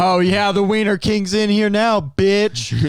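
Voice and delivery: loud, high, hammed-up announcement; mock taunting boast. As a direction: crow it like a playful challenge. Acts out a taunting confrontation.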